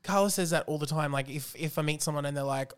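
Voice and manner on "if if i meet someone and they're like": usual speaking voice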